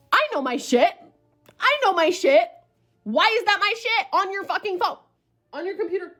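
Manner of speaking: high, rising indignant outburst; outraged disbelief. Retold or imitated. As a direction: blurt it out high, incredulous. Acting out shocked outburst at photo.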